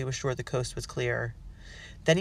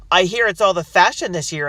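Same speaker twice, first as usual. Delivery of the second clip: loud, mocking heckle; heckling; 5 semitones higher, 11 dB louder than usual. A narrator's reading. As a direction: jeer over the crowd. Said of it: "another heckled".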